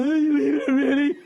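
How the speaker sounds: high, loud caricature of a complaining wife; mocking wife voice. Wife objecting to him leaving the party early, loud high mocking imitation. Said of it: "That's how she sounds when she says shit like that".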